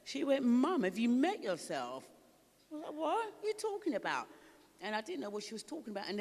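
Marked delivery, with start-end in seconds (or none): 0.4-2.0 higher, louder, slow teasing daughter; daughter's retort. Retold or imitated. 2.9-4.3 much higher, baffled protest; indignant reply. Retold or imitated.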